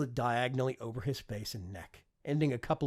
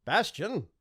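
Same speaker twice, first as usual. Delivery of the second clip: calling out; 7 semitones higher, 6 dB louder than usual. A narrator's reading. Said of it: "raised his voice slightly and called out".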